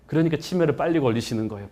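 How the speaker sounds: usual speaking voice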